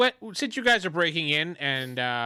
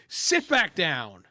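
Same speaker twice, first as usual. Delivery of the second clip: slow, drawn-out command; angry henchman; much slower than usual. Acted. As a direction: order a prisoner back to his seat. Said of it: "he just goes".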